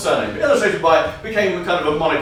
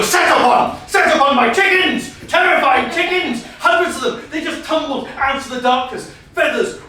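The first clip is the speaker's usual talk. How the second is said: ramp up the chaos; frantic tale of chicken attack; excited; 8 semitones higher than usual. Acted. Excited climax of story.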